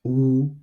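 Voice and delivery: U held high and level; first tone. Shown as a demonstration. Teacher modelling tones for repetition, U high and flat. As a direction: keep U steady at a high pitch. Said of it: "which is high and flat".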